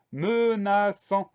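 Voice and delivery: "menaçant" drawn out slowly, each syllable separate; teacher's slow, careful "menaçant". Shown as a demonstration. Teacher introduces a word syllable by syllable.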